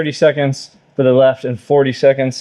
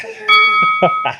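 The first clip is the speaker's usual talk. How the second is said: short winded laugh after effort; breathless laugh. Real, not acted. Laugh after finishing hard set.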